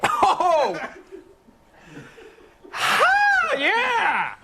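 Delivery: high yelps from the burn; pained whoop. Real, not acted. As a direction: cry out at the heat. Hot sauce burns, yelling out.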